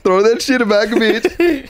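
high, hammed-up Indian accent; mock Indian accent. Repeating the bit to friend, high mock Indian accent. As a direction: demand it in a comic Indian voice. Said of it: "sounds like mad Indian".

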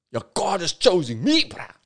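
high-pitched, self-important brag; mock egotism. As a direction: crow it like an inflated ego. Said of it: "builds your ego".

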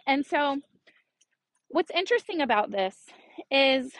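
usual speaking voice

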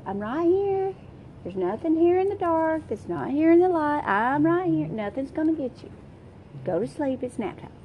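calm the little ones down to sleep; soothing four-year-olds. Retold or imitated. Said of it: "have I told the four-year-olds".

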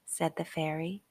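ordinary delivery, nothing marked

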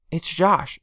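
quick bright rise on the name; lightly voiced aunt, a little excited. A narrator's reading. Aunt telling the family her husband is calling, excitedly. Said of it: "she said excitedly".

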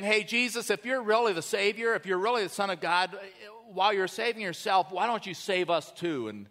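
smart-aleck taunt. Acted. Arrogant thief challenges Jesus to save him, smart-aleck taunting. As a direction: needle him with mock doubt. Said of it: "He is a smart aleck".

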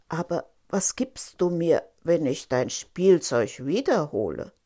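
low frog voice with swooping pitch; bargaining frog. Acted. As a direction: speak low as the frog, measured at first. (tiefe Froschstimme, verhandelnd)

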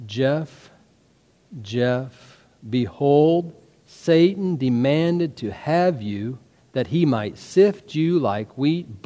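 slow, soft, measured scripture reading; solemn prayer reading. Shown as a demonstration. Pastor reading the prayer together with congregation, solemn and slow. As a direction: lead a congregation slowly through the words. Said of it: "we're gonna read this prayer".

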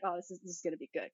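very soft, quick aside to oneself; hushed, hurried inner voice; private thought. Retold or imitated. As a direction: murmur it fast, as if thinking aloud. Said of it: "'cause in my head, I'm like".

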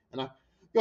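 usual speaking voice

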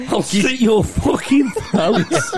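imitating the Scottish threat while laughing; mock Glasgow threat. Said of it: "the Glasgow accent itself is a weapon".

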